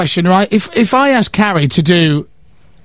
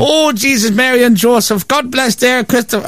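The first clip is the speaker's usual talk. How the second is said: higher-pitched, hammed-up Irish mother; mimicked Irish accent; 5 semitones higher than usual. Mates mocking his Irish mother, exaggerated Irish accent. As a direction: parody an Irish mum's blessing. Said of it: "she doesn't talk like that".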